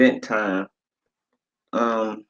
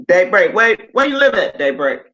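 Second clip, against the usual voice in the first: high, louder call to a viewer by name; 7 semitones higher, 4 dB louder than usual. Real, not acted. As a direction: pitch it up and call her. Calling out to a viewer.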